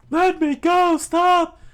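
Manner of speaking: very high, very loud frantic cries; panicked shouting. Acted. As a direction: struggle and scream to get free. Usopp grabbed and dragged.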